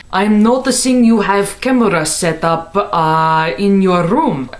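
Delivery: imitating a Russian neighbor's thick accent. Neighbor quizzing her about the camera in a mock Russian accent. Neighbor's creepy elevator question.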